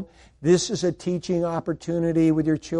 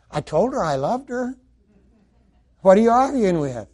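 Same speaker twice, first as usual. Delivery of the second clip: ordinary talk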